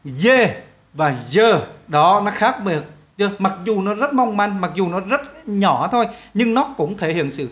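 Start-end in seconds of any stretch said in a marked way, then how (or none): 0.0-1.6 dê và dơ said very slowly, lower, each vowel drawn out; slow contrast. Shown as a demonstration. Teacher contrasting dê and dơ for students. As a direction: lay dê and dơ side by side slowly. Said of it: "các bạn nghe kỹ nè".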